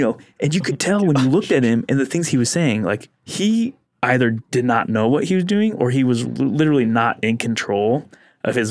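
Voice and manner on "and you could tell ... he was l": ordinary talk, nothing marked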